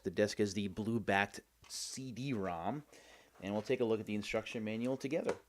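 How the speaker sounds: ordinary delivery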